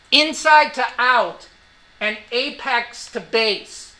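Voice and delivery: slow, every word weighted; slow emphatic. Real, not acted. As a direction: spell it out slowly for students. Lecturer hammering key phrase.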